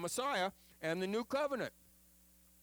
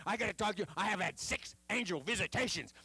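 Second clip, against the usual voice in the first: higher, eager rush of news; excited; 4 semitones higher, faster than usual. Retold or imitated. Jimmy grabbing the pastor at a funeral, imitating his excitement. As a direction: blurt it out like you can't hold it in. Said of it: "he's like this because it's so exciting".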